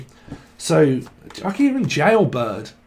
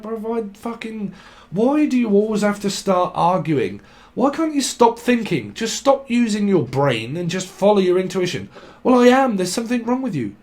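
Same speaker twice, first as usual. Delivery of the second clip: high-pitched exasperated pleading and retort; acted arguing; 4 semitones higher than usual. Partner asking why they always argue, exasperated. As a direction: play both sides of a needless argument. Acts out an argumentative exchange.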